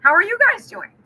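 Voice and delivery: the drive-through guy caught off guard but delighted; startled worker, pitched high and happy; surprised cheerful reply. Retold or imitated. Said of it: "He's like".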